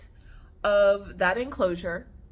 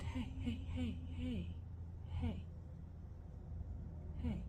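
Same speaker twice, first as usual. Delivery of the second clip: very soft, spaced-out calls; hushed soothing; 17 dB quieter than usual. Real, not acted. Owner calming her restless snake, hushed soothing. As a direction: gently settle an animal under your breath.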